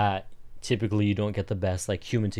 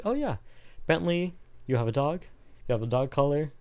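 lightly acted service rep, pitched up; friendly business representative; 6 semitones higher than usual. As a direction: play an interested phone agent, voice lifted. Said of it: "I am said representative of that business".

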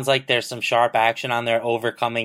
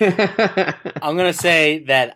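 laughing; 6 semitones higher, 4 dB louder, much slower than usual. Real, not acted. Laughing after joke about Browns.

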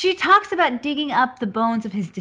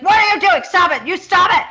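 high, loud retold yelling; escalating outburst; 10 semitones higher, 6 dB louder than usual. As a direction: escalate into a mock furious shout. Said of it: "he just escalates it".

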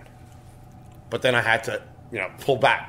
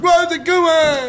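mocking trolls; 6 dB louder than usual.

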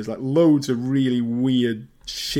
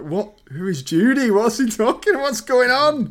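rising higher and louder with each question; more and more incredulous, climbing up; baffled disbelief; 10 semitones higher, 3 dB louder than usual. Real, not acted. Baffled by the scene.

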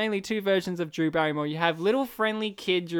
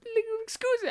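high, softer squeaky muttered fragment; Ben Stiller; 14 semitones higher, 6 dB quieter than usual. Acted. Host mimicking the interrupted actor, high squeaky caricature. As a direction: tail off in a squeaky fluster. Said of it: "It's him right now".

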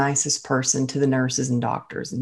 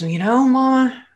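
daughter's voice; 8 semitones higher than usual. Retold or imitated. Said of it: "she goes".

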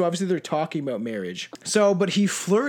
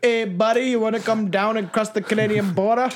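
higher-pitched mock Canadian accent; cartoonish Canadian come-on, pitched up; bad Canadian accent; 4 semitones higher than usual. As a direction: ham up a Canadian "buddy" accent. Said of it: "That's a terrible Canadian accent".

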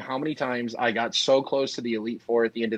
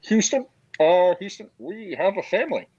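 mock movie clip; 8 semitones higher, 4 dB louder than usual. Joker playing a fake film clip to co-hosts, high mock-dramatic voice. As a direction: act out a fake movie audio clip, pitched up. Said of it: "exclusive audio clip that they made".

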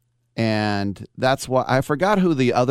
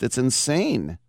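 ordinary talk

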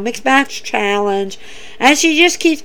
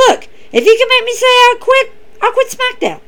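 much higher, hammed-up voice of the challenger accepting; confident challenger; 12 semitones higher than usual. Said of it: "She goes".

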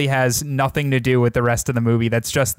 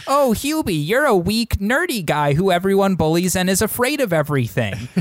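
mother voice; 6 semitones higher than usual. Retold or imitated. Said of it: "She's like".

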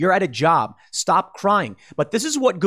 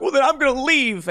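higher, sulky whining voice; lightly hammed-up whiny child; whiny kid; 10 semitones higher than usual. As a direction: pout like a spoiled child storming off. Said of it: "all the whiny kids are like".

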